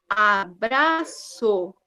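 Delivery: abraço drawn out slowly as A-BRA-ÇO; very slow abraço, split a, bra, ço; syllable by syllable. Shown as a demonstration. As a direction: sound out abraço in three beats, bra kept whole. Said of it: "Eu não posso separar o B e o R".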